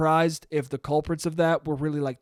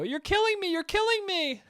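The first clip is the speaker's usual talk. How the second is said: much higher, hammed-up wail; mock melodrama; 14 semitones higher than usual. Pulling away mid-kiss to start a fake fight, hammed-up wail. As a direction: overplay the fake outrage.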